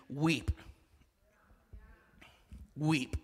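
low, soft, spaced single words; solemn whispered repetition; hushed weep. Acted. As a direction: let each weep fall quietly. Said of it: "preaching the point".

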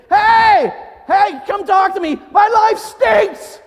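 high, loud, drawn-out holler; mock scream. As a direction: scream your troubles out to the neighbors. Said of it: "it doesn't say scream to your neighbors".

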